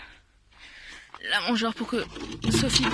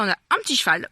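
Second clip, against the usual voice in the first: usual speaking voice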